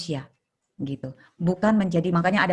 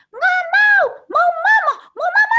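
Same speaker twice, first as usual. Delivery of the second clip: put-on shrill, much higher, loud child's scream for mama; 8 dB louder, slower than usual. Therapist imitating a girl screaming in the therapy room, much higher and loud. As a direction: scream it like a child wailing from the far end of the hall. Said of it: "anak ini menjeritnya itu dari ujung ke ujung".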